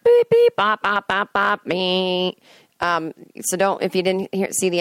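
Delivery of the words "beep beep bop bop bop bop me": bleep out the spoiler; censor bleep. Real, not acted. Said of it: "spoiler alert".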